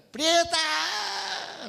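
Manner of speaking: high, loud yell across the field; calling out loud. Retold or imitated. Farmer calling his cow from far away, a loud high yell. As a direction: shout the cow's name from far off. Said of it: "Y luego le grita".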